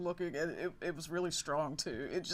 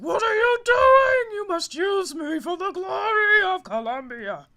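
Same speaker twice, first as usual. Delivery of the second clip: high, slow, booming shout; shouting general; 13 dB louder, slower than usual. Acted. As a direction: bellow like a proud warrior. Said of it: "He's like".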